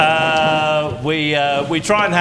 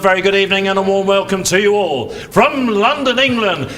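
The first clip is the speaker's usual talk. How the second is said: announcer welcoming the crowd to a title fight, booming; boxing ring announcer; 3 semitones higher than usual. Acted. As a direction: call the fighters into the ring at the Royal Albert Hall. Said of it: "you're a prize fight announcer".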